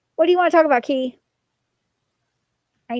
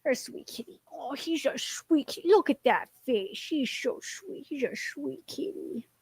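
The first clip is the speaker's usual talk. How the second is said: cooing pet-talk; 7 dB quieter than usual. Real, not acted. Streamer fusses over the sweet cat, hushed baby talk. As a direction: coo almost under your breath to a kitten.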